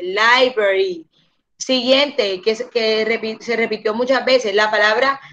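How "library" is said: slower, lower "library" starting with lie; correct library. Shown as a demonstration. Teacher restating the correction, library starting with lie. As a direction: round off library with the eye vowel. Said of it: "se dice library".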